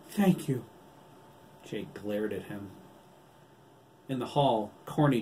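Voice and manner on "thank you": high, loud outpouring of thanks; effusive gratitude. A narrator's reading. Doctor on his knees thanks his rescuer, loudly effusive. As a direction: let the thanks burst out.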